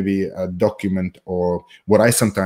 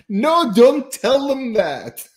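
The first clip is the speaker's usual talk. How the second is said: much higher, drawn-out plea; mock protest; 13 semitones higher, slower than usual. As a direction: protest in a slow, high put-on voice. Acted protest in imagined audience reaction.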